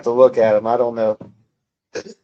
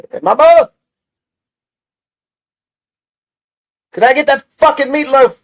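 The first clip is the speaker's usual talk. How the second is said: calling out; 12 semitones higher, 7 dB louder than usual. Real, not acted. Calling out to his mother.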